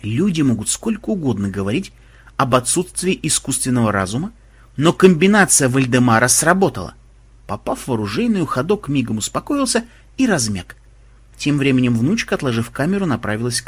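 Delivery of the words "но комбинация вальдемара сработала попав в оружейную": plain reading voice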